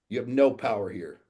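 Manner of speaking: loud, forceful challenge; imitated yelling. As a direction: retell a defiant yell. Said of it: "yells in my face".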